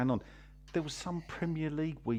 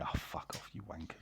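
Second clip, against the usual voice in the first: muttered; 7 semitones lower, 12 dB quieter than usual. Retold or imitated. Muttered retort to fans.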